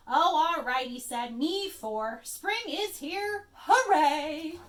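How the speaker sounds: loud, from grudging sigh to big cheer; reluctant then cheering. Acted. Rabbit finally joining his friends' cheer, building loud. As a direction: give in, then shout hooray.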